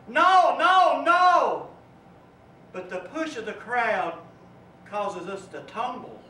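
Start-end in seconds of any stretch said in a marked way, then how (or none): 0.1-1.7 rising desperate cries of no; panicked no. Acted. Preacher voicing the falling crowd, desperate cry. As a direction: cry out as you slip over the edge.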